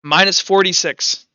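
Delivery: normal talking voice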